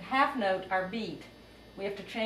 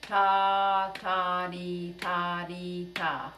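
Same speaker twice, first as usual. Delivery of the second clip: spoken rhythm pattern, first ta louder; call rhythm; 3 dB louder, much slower than usual. Shown as a demonstration. Teacher calls a rhythm for students to echo. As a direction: lay out the pattern clearly. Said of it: "I'll say a rhythm and you say it back".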